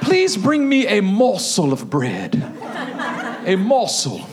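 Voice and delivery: slow, mock British accent; hammed-up British accent for a polite request. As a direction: say it as a very proper Brit. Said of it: "I think he said this with a British accent".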